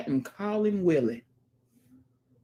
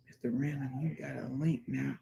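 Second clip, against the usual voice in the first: ordinary talk, nothing marked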